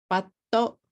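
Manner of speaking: "Batto" in two beats, stopped t then o; Japanese-style BUT, a vowel added at the end; katakana English. Shown as a demonstration. Teacher counting syllables for learners, katakana English. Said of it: "two syllables".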